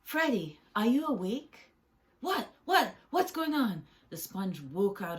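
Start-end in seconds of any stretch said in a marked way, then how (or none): 0.0-1.7 loud, higher-pitched wake-up call; raised voice, urging someone awake. Acted. Sam calls sleeping friend awake. 2.2-3.9 loud, high, flustered outburst; high-pitched, bewildered yelp of questions; startled awake. Acted. Sponge startled out of deep sleep.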